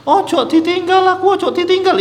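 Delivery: high, loud mock wail; wailing mother. Preacher imitates a mother wailing over her dead son, high mock wail. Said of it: "Ibunya gulung-gulung nangis".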